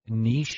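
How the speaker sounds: Niche said "neesh" with a mock accent; lightly accented "neesh", rising at the end. Shown as a demonstration. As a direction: drawl Niche out as a fancy "neesh". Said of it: "saying things with an accent".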